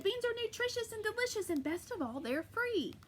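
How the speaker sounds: high, sing-song praise of beans; cheery giantess. Acted. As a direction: sell the beans to him.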